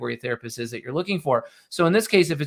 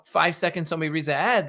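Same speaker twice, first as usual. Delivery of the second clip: usual speaking voice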